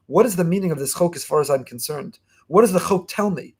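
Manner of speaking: normal talking voice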